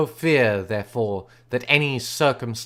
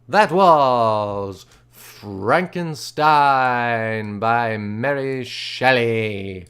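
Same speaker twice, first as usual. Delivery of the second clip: drawn-out announcer outro; slow grand sign-off; much slower than usual. Acted. Reader closing the Frankenstein episode, slow and grand. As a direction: stretch every word like a host. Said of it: "slow mock-grand outro".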